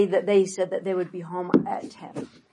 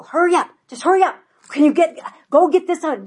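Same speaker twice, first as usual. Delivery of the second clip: mock yell, higher and louder; retold yelling at kids; 9 semitones higher, 7 dB louder, faster than usual. Mother hurrying kids to school, yelling. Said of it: "I used to yell at my kids".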